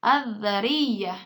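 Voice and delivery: very slow "adzariyah" with a held voiced TH; silent lam. Shown as a demonstration. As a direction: draw out "adzariyah", no L. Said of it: "Lamnya tidak dibaca jelas".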